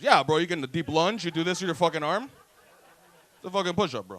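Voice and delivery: lightly acted loud, defensive bro; mock indignant insistence. Push-up guy defends his form to a doubter, mock indignant.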